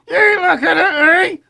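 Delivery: squeaky puppet tough guy. Acted. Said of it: "He's trying to say".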